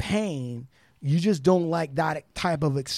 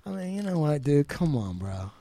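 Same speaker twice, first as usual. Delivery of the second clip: mock reproach; 6 dB quieter than usual. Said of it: "there's a reproach that I'm like".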